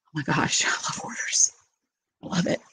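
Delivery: hushed gleeful aside with a laugh; confiding whisper. Real, not acted. Host admits her love of Hoarders to viewers in a whisper. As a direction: whisper the guilty pleasure.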